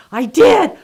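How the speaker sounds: loud, high-pitched retort; emphatic insistence. Retold or imitated. New Zealander answering her doubters, loud and emphatic. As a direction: act out insisting, voice jumping up loudly. Traveler's emphatic acted reply.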